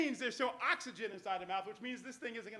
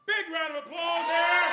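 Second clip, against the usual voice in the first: loud, slow showman's call for applause, pitched higher; rally the crowd to clap; big announcer shout to the audience; 6 semitones higher, 12 dB louder than usual. Real, not acted.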